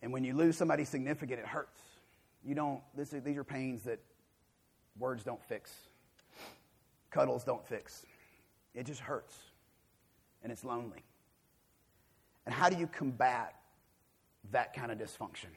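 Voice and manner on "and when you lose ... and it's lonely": soft, pausing, weighty delivery; quiet sorrow. Real, not acted. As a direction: let each hurt settle softly. Describing the hurt of loss.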